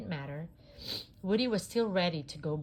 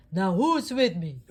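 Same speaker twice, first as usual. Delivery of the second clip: loud heroic rally cry; rallying call; 10 dB louder than usual. Acted. Buzz rallying toys, loud heroic call. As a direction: rouse the troops.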